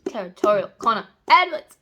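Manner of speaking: loud, emphatic fangirl demand; excited craving. Real, not acted. Reader needs some of this hero, loud excitement. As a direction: belt it out like you're craving it.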